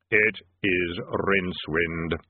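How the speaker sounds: low, slow, hollow Death voice; grim reaper voice. A narrator's reading. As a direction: speak slow and deep as Death. Death speaks.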